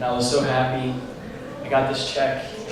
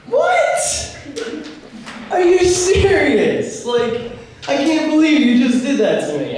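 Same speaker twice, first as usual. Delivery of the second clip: much higher, louder, slow stunned squeal; shocked disbelief; 12 semitones higher, 4 dB louder, slower than usual. Retold or imitated. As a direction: gasp it out in total disbelief. Dad actually dials 911.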